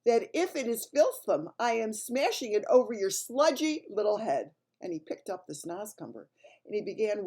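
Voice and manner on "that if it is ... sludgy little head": loud, menacing Bloodbottler threat; threatening giant. A narrator's reading. Bloodbottler warns the BFG, loud and threatening. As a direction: threaten to smash his head.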